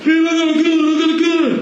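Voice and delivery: retelling a friend's alarm in a mock high voice; imitating a panicked guy. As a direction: mimic him pointing out the gun, up high. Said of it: "And he like".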